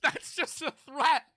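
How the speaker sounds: high, slow, laughing through it; can barely get it out for laughing. Real, not acted. Reacting to the sword joke, laughing hard.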